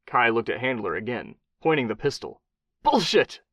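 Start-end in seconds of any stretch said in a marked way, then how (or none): 2.8-3.4 high, loud scream. A narrator's reading. Attorney General screaming at Kai, loud high scream. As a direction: scream it in his face. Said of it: "Handler screamed at him".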